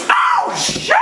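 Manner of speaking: sudden high pained outburst; high-pitched mock yelp. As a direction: cry out as if you just got smacked. Mimes smacking himself.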